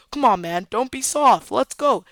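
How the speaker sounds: goading. Retold or imitated. Tyler to his own brother, goading urging. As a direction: push him to come along, voice rising.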